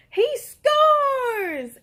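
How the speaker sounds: high, loud victory shout; boy whooping over his trash-can basket; excited yell. A narrator's reading. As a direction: cheer it like a game-winning shot. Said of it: "Aiden yelled".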